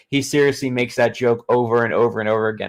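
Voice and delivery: ordinary delivery, nothing marked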